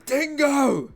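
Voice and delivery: high loud cry of the name; mock anguished yell; anguished scream. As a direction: scream the name in hammed-up grief. Character sees Dingo get shot.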